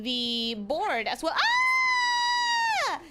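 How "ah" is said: sharp high yelp of surprise; a sudden loud "ah" as it hits you; surprised shout. Real, not acted. Sudden realization at the board.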